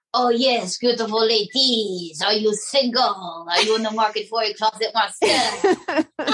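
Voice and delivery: a hint of a French seducer; flirting French charmer. Puppet flirts.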